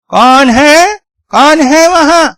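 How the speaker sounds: high, loud cry into the night; startled scream. Acted. Grandmother woken by a noise calls out, high startled scream. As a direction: shriek out a challenge on waking. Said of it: "वो चीख पड़ी".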